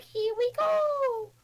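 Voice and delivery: high, slow cartoon plumber cry; Mario voice. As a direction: chirp it like the game Mario. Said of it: "where he's like".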